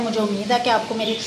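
usual speaking voice

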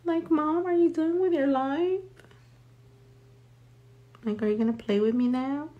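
very high, soft whiny pet voice; high soft whiny dog voice; dog's whiny voice. Retold or imitated. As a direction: voice the dog nagging its mom. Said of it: "He's gonna be like".

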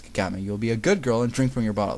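plain reading voice

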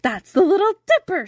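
high, loud cry of recognition; Birdling's high excited yell; excited shout. A narrator's reading. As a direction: shout it out in delighted discovery. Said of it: "shouted Birdling".

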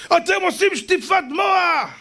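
drawn-out, very high accusing yell; acted angry shouting. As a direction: imitate a furious father screaming at the speaker. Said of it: "והוא צועק". (צעקה גבוהה ומתוחה, בחיקוי אב זועם)

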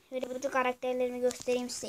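ordinary talk, nothing marked

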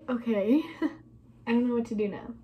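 ordinary delivery

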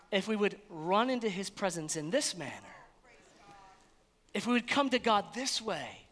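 normal talking voice

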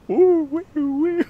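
hooting high and loud, imitating his swaying; high loud woo. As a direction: woo it out like a dazed man swaying.

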